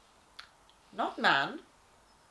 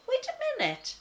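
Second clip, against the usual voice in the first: much higher, sudden alarm; startled objection; 5 dB louder than usual. Acted. Lion narrator objecting to the plan, startled and high. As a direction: stop them short in shock.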